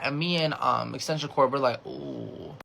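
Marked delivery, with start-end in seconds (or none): 1.8-2.5 very soft, low "ooh"; awkward reluctance. Retold or imitated. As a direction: shrink into a drawn-out, reluctant murmur. Awkward reaction to money request.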